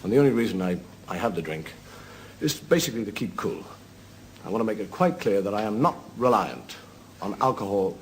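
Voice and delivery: normal talking voice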